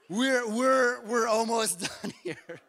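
real laugh creeping into the words. Real, not acted. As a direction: talk through a real laugh. Breaks into laughter after shouting.

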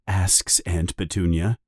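plain reading voice

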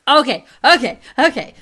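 loud, high, emphatic okays; bracing to rant, loud and high. Real, not acted. Podcast host gearing up, emphatic. Excited reaction before a rant.

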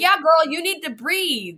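high, knowing reassurance from a friend; a hint of a girlfriend telling her to calm down; lightly voiced girlfriend. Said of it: "And they'll be like".

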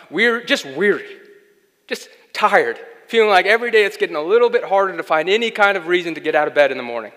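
loud, high, driving sermon delivery; loud, rising preacher's cadence; full-force preaching. Acted. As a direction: preach it hard to a weary congregation. Opening of the sermon.

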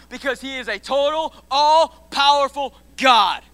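slow shout. Real, not acted. Preacher shouting.

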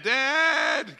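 put-on high child call, loud; a scared little boy calling for dad; boy's high call for his father.

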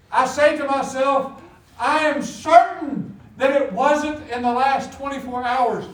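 loud, high, measured certainty; preacher driving each word home; emphatic preaching. Real, not acted. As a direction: declare it slowly and forcefully. Preaching, emphatic aside to himself.

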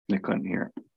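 soft, hushed repeat of the line; a quiet echo after the first take. Shown as a demonstration. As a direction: let it fall away almost to a murmur.

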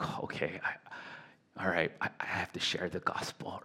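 a nervous private whisper, barely voiced; whispered inner thought. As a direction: whisper it to yourself, hushed and hesitant. Inner thought before an awkward sermon.

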